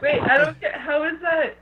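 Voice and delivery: puzzled through real giggles; giggly confusion. Real, not acted. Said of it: "I think the laughter has made her high".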